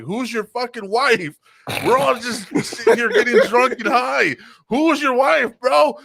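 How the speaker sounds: high, loud, baffled yelling; incredulous shout. Retold or imitated. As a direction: shout it back in disbelief. Drunk wrestlers answer.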